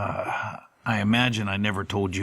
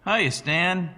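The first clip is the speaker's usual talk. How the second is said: high, strained cheerful greeting; forced enthusiasm; 10 semitones higher than usual. Acted. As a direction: fake a cheery hello. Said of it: "I attempted enthusiasm".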